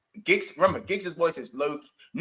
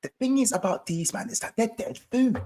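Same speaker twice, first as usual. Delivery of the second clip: higher condescending pundit voice, laughing through it; Gunali impression; 8 semitones higher than usual. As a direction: put down the team like Gunali does. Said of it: "when Gunali is talking to me and he wants to insult me".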